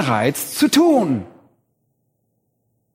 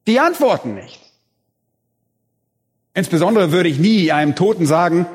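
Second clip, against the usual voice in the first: ordinary delivery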